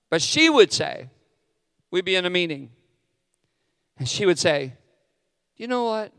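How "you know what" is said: a light, soft imitation of his wife; gentle wife voice. Pastor mimics his wife's kind correction softly. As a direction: voice her gently, nice about it. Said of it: "That's exactly how she would say it".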